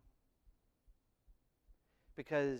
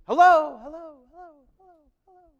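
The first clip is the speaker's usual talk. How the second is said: loud high hello fading to a distant echo; 12 semitones higher, 14 dB louder, faster than usual. Shown as a demonstration. Preacher imitating his voice echoing in a cave, loud then fading. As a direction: call out and let it echo away. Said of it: "did I fade out just right".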